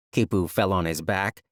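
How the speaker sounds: ordinary delivery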